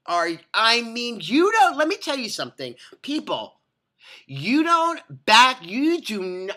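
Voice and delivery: high, drawn-out imagined scolding; slow, high-pitched "back off" rebuke; indignant telling-off. Retold or imitated. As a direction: stretch out each word in exasperated warning.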